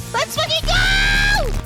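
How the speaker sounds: slow, high drawn-out cheer; hype shout. Real, not acted. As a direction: stretch it out like a battle cry. Hyping the game start.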